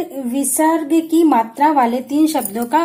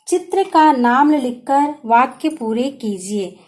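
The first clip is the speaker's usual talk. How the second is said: ordinary talk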